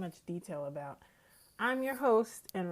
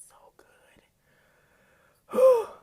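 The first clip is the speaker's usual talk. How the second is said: hushed then shouted; 19 dB louder than usual. Real, not acted. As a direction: whisper building to a burst. Raving about steak.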